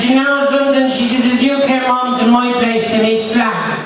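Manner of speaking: high, tipsy Dublin woman; a merry drunk lady. Retold or imitated. Drunk woman after eighteen brandies.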